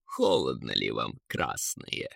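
low, soft eagle taunt; mocking. A narrator's reading. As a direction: sink deep and quiet to rub it in. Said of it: "издевался орёл". (издевательски, тихо и низко)

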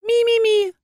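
very high, sad little cheeping; plaintive tiny animal cry; mournful creature squeak. Acted. Зверёк на груди героини тоскливо пищит, высоко и жалобно. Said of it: "раздалось тихо и тоскливо".

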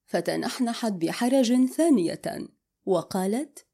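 ordinary delivery

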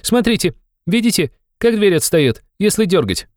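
high, urgent youthful explaining; excited young man. A narrator's reading. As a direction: point out a discovery eagerly, pitched high. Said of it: "закричал вдруг молодой человек". (взволнованный высокий молодой голос)

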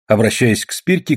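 ordinary delivery

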